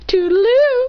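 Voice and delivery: a smug character chirping goodbye; mocking sing-song farewell.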